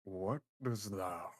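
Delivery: slow, echoing eldritch demon voice; demonic voice. Acted. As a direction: speak slowly as a vast ancient demon. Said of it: "echoing, booming, demonic voice".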